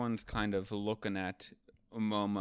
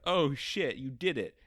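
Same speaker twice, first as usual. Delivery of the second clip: louder, higher imitated delight; thrilled amazement; 5 semitones higher, 6 dB louder than usual. Luffy amazed that Momo bit Kaido, mock thrilled. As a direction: cheer in surprised, retold glee.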